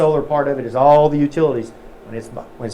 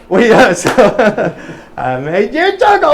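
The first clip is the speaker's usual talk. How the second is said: higher, louder, laughing through the words; real laughter; 7 semitones higher, 7 dB louder than usual. Real, not acted. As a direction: still giggling from the punchline. Laughing after the joke.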